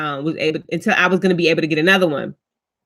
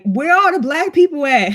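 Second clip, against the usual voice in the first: mock incredulous friend, pitched up; teasing friend voice; 7 semitones higher, 3 dB louder than usual. Friend teasing her over text, pitched-up mock. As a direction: raise the pitch, poke fun like a friend.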